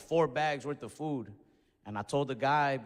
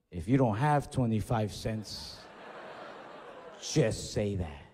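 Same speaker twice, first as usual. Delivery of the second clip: lower, slow, condescending drawl; snide cashier; 6 semitones lower, slower than usual. Retold or imitated. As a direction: talk down to him slowly. Cashier mocks him, lower voice.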